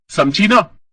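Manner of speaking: loud, bossy warning, समझी ना rising; threatening. Acted. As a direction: press the warning home on ना.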